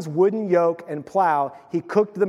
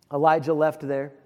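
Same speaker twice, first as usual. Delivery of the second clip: normal talking voice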